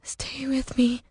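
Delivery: high, slow, breathy plea; tearful plea. Acted. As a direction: beg breathily, close to tears. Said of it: "hot tears cutting down her frozen face".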